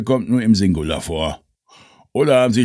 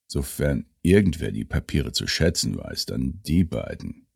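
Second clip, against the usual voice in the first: low conspiratorial voice; 6 semitones lower, 5 dB quieter than usual. Acted. Couple dialogue, low soft.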